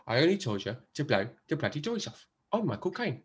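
a modest Cockney Caine delivery of the famous line; Michael Caine impression. Host quoting The Italian Job, a soft, understated Caine. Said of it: "That's more like Michael Caine".

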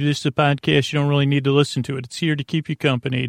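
usual speaking voice

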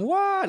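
very high-pitched cartoon wail; Mario's yelp. Mario bouncing around the lava, high yelping. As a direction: mimic a game character yelping. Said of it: "Mario ... starts going".

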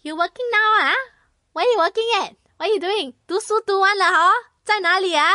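high, prying auntie voice, Singlish lilt; nosy auntie. Retold or imitated. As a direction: pester her like a nosy auntie at New Year. Nosy aunties at New Year.

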